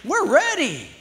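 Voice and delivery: high, loud burst of excitement; excited spirit. Acted. Preacher voicing his spirit, loud excited whoa. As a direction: let the spirit leap up, ready to go. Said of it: "your spirit goes".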